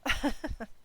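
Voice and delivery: short embarrassed laugh; sheepish laugh; light laugh; real chuckle. Real, not acted. Reader laughs about losing voice.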